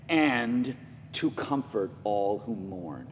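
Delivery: low, soft, slow scripture reading; gentle comforting. Acted. Preacher reads comfort verse in contrast to vengeance, gently. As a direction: ease right down, slow and hushed.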